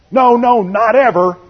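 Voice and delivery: high, urgent no, no; emphatic protest. Retold or imitated. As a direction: blurt out a shocked refusal. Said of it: "He goes from one end".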